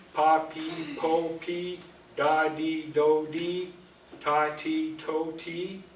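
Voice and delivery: each syllable clear, one after another; reciting syllables. Shown as a demonstration. Teacher pronouncing syllable chart for learners, clear. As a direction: drill the chart aloud. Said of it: "That's how you pronounce them".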